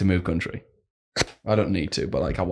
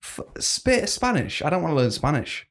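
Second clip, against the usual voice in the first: high-pitched baulking at "Spanish"; mock incredulous; 7 semitones higher than usual. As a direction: stammer into a high protest, then ease down a little. Retelling his own reluctant reaction.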